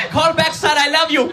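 very high-pitched mock panic; panicked Korean mom. Mom picks up beside the answer machine, panicked Korean mom. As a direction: imitate a mother losing her mind at phone feedback. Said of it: "she'll lose her mind 'cause of the feedback".

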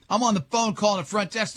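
ordinary talk

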